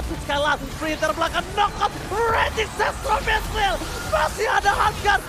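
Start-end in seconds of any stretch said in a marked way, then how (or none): 1.6-5.2 fighting game announcer. Acted. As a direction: call the fight at full hype. Hypes a teamfight live.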